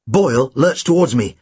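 ordinary delivery

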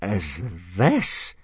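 slow-building appalled question; appalled demand. A narrator's reading. Instructor on seeing the puny dragon, appalled. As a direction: finish the demand in disbelief. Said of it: "demanded Gobber, blanching a little".

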